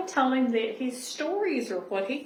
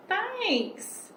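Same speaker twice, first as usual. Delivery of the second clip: high, lightly voiced bear saying thanks; a hint of a shy bear, pitched up; bear's thanks; 10 semitones higher than usual. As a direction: squeak out a small grateful thanks. Bear character line in story.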